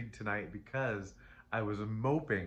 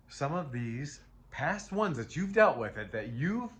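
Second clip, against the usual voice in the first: building emotion; 7 semitones higher, 5 dB louder than usual. Real, not acted. Reader to viewer, building to an emotional high, louder and higher.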